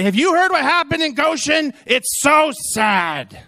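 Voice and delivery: high, slow mock-pitying echo; turning the lady's words back on her, mock pity; mimicking the lady. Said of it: "I find that lady ... and say".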